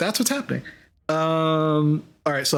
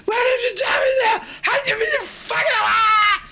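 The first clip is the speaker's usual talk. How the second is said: furious shouting. Acted. GM voicing a raging Rex, shouting at the Dragonborn. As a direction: scream it out in a rage. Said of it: "Like, he's going crazy".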